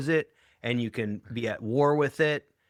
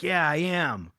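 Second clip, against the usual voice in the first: defiant retort, imitated; 5 semitones higher, slower than usual. Retold defiant reply in neighbour row.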